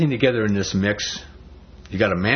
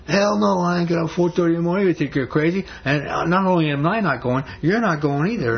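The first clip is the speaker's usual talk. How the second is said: indignant refusal; 8 semitones higher than usual. Acted. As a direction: voice a fed-up wife flatly refusing, pitched up. Wife's refusal voiced in story.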